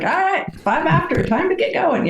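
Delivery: bright, high, cheerful wrap-up call; brisk instructor cutoff. Retold or imitated. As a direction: cut them off nicely but firmly. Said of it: "really good at cutting people".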